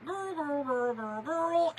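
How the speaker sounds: put-on girls blurred into one run; real-fast girl noise. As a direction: imitate her friend's quick girl trick. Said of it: "all it is is saying 'girl' real fast".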